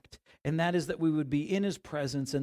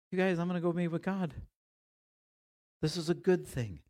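casual; 4 semitones higher, faster than usual. Retold or imitated.

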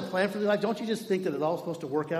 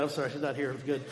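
ordinary delivery

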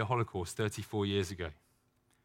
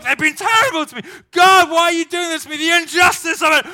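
much higher, much louder outraged shouting at God; furious ranting; 15 dB louder than usual. Acted. Believer shouting at God over a test, outraged. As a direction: rage at God like a wronged child, full volume.

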